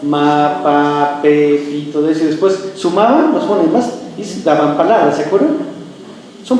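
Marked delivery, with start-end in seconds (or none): 0.0-1.9 syllables chanted low on one level note; like drilling phonemes in class; schoolroom phoneme recitation. Retold or imitated. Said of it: "cómo nos enseñaron a entender palabras".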